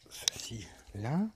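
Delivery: slow whispered aside; slow private whisper to yourself. Real, not acted. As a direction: breathe it under your breath, slowly. Murmuring aside while setting things down.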